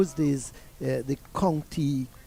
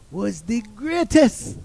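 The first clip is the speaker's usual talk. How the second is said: slow, high, louder Jamaican declaration; Jamaican coach's praise; 7 semitones higher, 6 dB louder, slower than usual. Retold or imitated. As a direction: proclaim it in Jamaican, drawn out. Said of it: "So he said".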